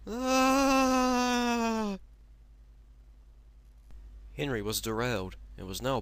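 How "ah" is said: very high crying scream. Acted. Henry derailing, crying scream. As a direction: wail as you derail.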